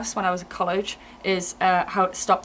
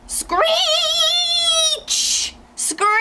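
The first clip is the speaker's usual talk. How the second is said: high sharp screech. Shown as a demonstration. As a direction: screech the word out. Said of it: "Second one is gonna be a screech".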